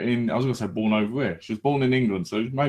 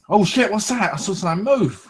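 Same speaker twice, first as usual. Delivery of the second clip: mock startled fright; 8 semitones higher than usual. Acting out spotting Nessie.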